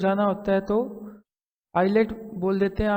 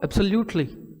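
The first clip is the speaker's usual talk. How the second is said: British English way. Shown as a demonstration. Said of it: "तो अंग्रेज क्या बोल देंगे इसको?".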